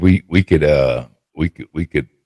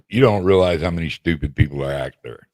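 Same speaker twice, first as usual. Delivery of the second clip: ordinary talk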